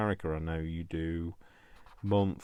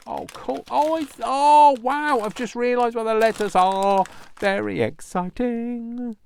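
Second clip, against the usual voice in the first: excited; 12 dB louder than usual. Real, not acted. Unboxing, thrilled at discovery.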